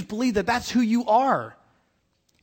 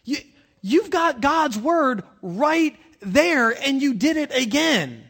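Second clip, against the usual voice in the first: Peter scolding Steve; 5 semitones higher, slower than usual. Retold or imitated. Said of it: "being like".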